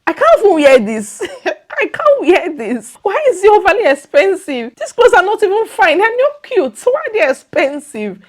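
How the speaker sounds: high, loud, outraged shopper's whine with a laugh; a shopper appalled by sixty-dollar clothes, loud and high; indignant disbelief. Retold or imitated. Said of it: "And you're like".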